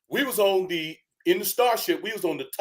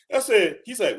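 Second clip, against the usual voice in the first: usual speaking voice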